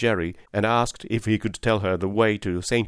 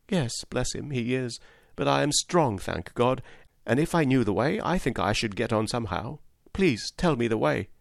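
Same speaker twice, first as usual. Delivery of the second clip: higher-voiced, earnest young woman; pleading poor mother; 4 semitones higher than usual. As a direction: plead gently but stay determined.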